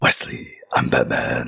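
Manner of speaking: low lightly voiced caped hero; Batman voice. Shown as a demonstration. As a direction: drop low like a brooding hero. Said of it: "that was supposed to be, "I'm Batman".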